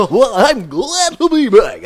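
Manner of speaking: a hammed-up DJ welcome; radio host voice. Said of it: "I feel like talking like a radio guy".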